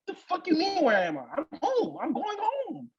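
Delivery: cracking up at the nerve; incredulous laugh at her call; laughing disbelief. Real, not acted. Outraged retelling, laughing.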